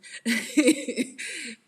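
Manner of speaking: loud, high burst of laughter; bright cackling giggle; cheeky laugh. Real, not acted. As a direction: crack up at your own cheeky joke. Laughs at joke about her husband.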